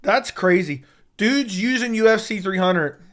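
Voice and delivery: usual speaking voice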